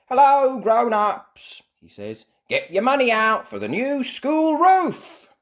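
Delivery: high, loud microphone calling; cheeky announcer dog. Acted. Danny Dog on the microphone calling grown-ups, loud.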